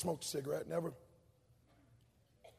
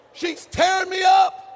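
loud, slow, higher shout on "tearing me up"; 9 semitones higher, 12 dB louder, slower than usual. Acted. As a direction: drive it home at full volume. Recalling the whipping.

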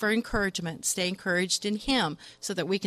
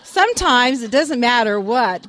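laughing; 3 semitones higher, 9 dB louder than usual. Real, not acted. Laughing after the joke.

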